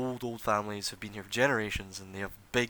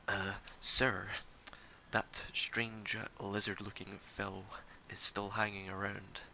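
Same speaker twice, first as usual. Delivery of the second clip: softer, slow hushed servant aside; aside to master; 7 dB quieter, slower than usual. Acted. Servant Skerin whispers a warning to Anders, hushed and slow. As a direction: murmur a warning to your lord. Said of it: "Skerin behind him says".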